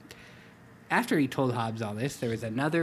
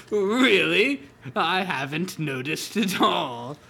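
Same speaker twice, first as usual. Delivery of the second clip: laughing; 4 semitones higher, 5 dB louder, slower than usual. Acted. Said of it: "Calvin laughed".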